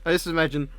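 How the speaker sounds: ordinary delivery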